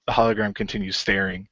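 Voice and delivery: ordinary talk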